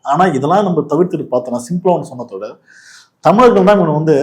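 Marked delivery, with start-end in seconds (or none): none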